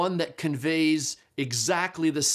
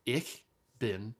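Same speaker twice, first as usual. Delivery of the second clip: ich bin kalt spelled out slowly, each word apart; softer, deliberate beginner's German; slow, careful demo; 3 semitones lower, 5 dB quieter, slower than usual. Shown as a demonstration. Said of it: "that's how you say it".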